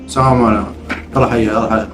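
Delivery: ordinary talk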